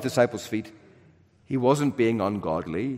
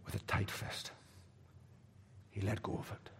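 hushed; 3 semitones lower, 18 dB quieter than usual. Real, not acted. Sermon, gentle image of letting go.

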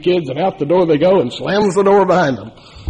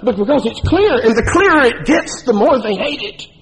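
higher, building fervor to a peak; preaching climax; 7 semitones higher than usual. Real, not acted. Preacher to the congregation, rising fervent build. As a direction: drive it up to the climax.

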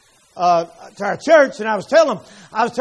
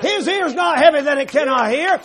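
full-force preaching; 5 semitones higher than usual. Acted. Preacher exhorts congregation, at full force. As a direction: preach it to the rafters.

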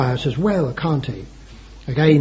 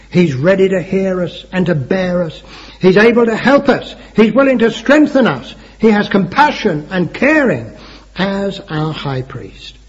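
high, loud preacher's rallying cry; preaching; 5 semitones higher, 5 dB louder than usual. Real, not acted. Preacher assuring his congregation of help, preaching. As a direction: preach it out in rising, forceful phrases.

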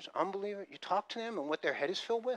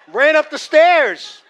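loud, high, drawn-out urgency; desperate dash home; frantic rush; 11 semitones higher, 19 dB louder, much slower than usual. Retold or imitated. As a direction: hurry up the stairs. Racing to the toilet.

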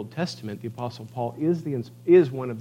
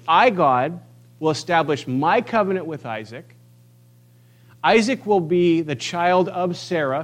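booming proclamation; 4 semitones higher, 5 dB louder than usual. Acted. Preacher voicing El Shaddai to the congregation, booming. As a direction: proclaim it like the Almighty.

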